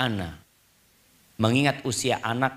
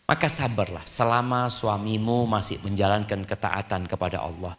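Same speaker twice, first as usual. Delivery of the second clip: usual speaking voice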